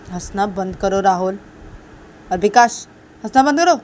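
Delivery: loud stern order; acted scolding. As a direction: raise the voice to shut them up. Siya orders them to stop laughing.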